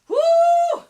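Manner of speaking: loud high whoop. Real, not acted. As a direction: let out a big high whoop. Releasing her built-up frustration.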